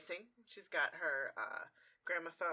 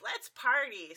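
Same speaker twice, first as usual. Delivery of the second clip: excited cheer; 6 semitones higher, 7 dB louder, slower than usual. Retold or imitated. Pinkie Pie cheers at party.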